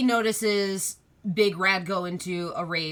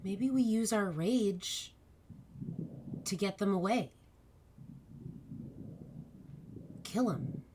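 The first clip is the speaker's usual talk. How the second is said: pixie; 9 dB quieter than usual. Acted. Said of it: "you hear a little voice in your ear saying".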